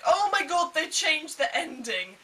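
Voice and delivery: loud, high squeal of shocked delight; excited friend. Retold or imitated. Friend turning after the film, loud excited outburst. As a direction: burst out like the thrilled friend.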